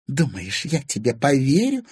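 incredulous question on an outbreath; exhaled. A narrator's reading. Anfisa disbelieving Timofey's assurance, exhaling it. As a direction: breathe out the doubt. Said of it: "выдохнула Анфиса".

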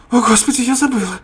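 high, loud dismayed cry; alarmed exclamation. Acted. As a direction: gasp it out in alarm. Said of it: "воскликнула Сара".

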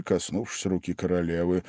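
plain reading voice

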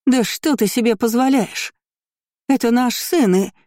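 high-pitched indignant tirade; outraged roar. A narrator's reading. Lady confronts the man over her son, outraged. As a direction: rail at him like an offended mother. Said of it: "взревела леди Эйренберг".

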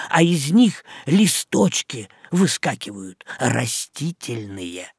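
ordinary delivery

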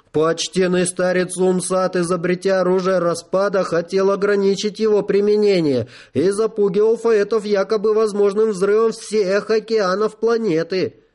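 high, cocky rooster-like voice; crowing proud rooster. A narrator's reading. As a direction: lecture like a strutting cockerel. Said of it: "петушиным голосом". (петушиным голосом)

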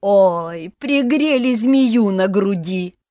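loud slow wailing lament; lamenting aunts. A narrator's reading. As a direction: wail in mock outrage. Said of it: "причитали тётки".

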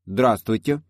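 put-on boy putting on a manly voice. A narrator's reading. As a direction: deepen it like a grown man. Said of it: "сказал он мужественным голосом".

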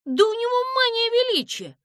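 high-pitched, loud gasp of astonishment; scandalized high outburst; gasped shock. A narrator's reading. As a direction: exclaim it aghast, voice shooting up. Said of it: "ахнула Бряка".

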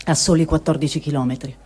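ordinary delivery

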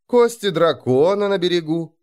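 high, insinuating purr. A narrator's reading. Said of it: "промурлыкал Мороз".

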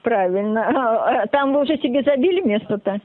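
ordinary talk

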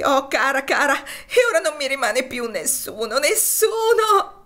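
high, loud, with a long slow drawn final wail; keen it out in despair. Acted. Topsy mourns she has no one left, a long desperate wail. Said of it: "I suoi singhiozzi raddoppiarono".

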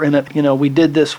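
usual speaking voice

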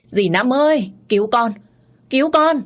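high, loud terrified cry for help; girl pinned down screams for the aunt, terrified; screaming for help. A narrator's reading. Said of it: "tôi khóc, tôi hét lớn".

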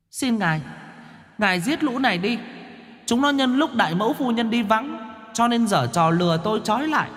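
put-on a small child begging the master to punish; child accusing. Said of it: "mà quát xa xả". (đứa trẻ nhỏ giọng cao, vừa van vừa tố cáo lũ quỷ)

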